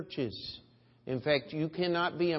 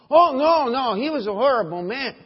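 higher, louder mock-horrified no; shocked protest; 10 semitones higher, 13 dB louder than usual. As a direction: play the appalled listener, hammed up. Retold shocked reply.

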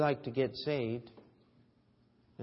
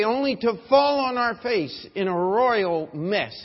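loud, ringing pulpit voice; full-force preaching; 7 semitones higher, 11 dB louder than usual. Acted. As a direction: preach it out to the whole congregation.

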